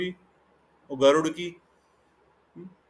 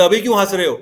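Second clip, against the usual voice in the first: challenging; 3 semitones higher, 8 dB louder, faster than usual. Acted. Garuda challenges laughing Yamraj.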